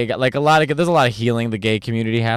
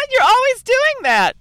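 very high, slow, mock-giggly voice; 3 dB louder than usual. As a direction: squeal it like a giddy pop star. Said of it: "She's always laughing".

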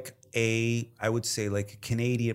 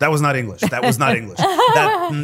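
louder, higher, laughing through the words; laughing disbelief; 7 semitones higher, 10 dB louder than usual. Retold or imitated. Retelling baffling English, laughing loud disbelief. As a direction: crack up while repeating the line. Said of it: "you're like".

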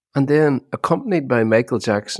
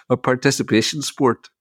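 ordinary talk

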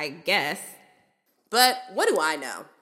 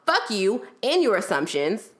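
ordinary delivery

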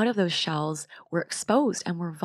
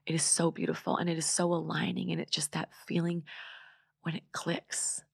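soft and calm; 6 dB quieter than usual. Real, not acted. Closing reflection, fading.

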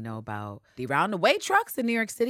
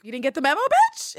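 1 sassy retort; 11 semitones higher, 7 dB louder than usual. Retold or imitated. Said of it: "And Eve is like".

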